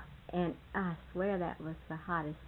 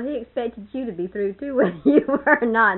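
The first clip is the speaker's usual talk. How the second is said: laughing; 3 semitones higher, 12 dB louder than usual. Real, not acted. Laughing while retelling the meal.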